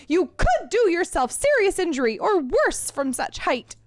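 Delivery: high, emphatic scolding; shrill admonishment. Acted. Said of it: "I was aiming for stern admonishment".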